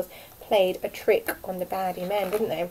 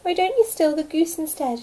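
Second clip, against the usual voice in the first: higher, louder squawky pleading; pleading duck; 9 semitones higher, 8 dB louder than usual. A narrator's reading. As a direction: beg like a frightened duck. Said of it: "the downy duck began to quack".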